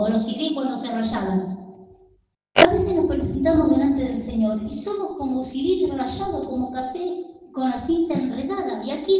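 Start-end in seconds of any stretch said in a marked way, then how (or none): none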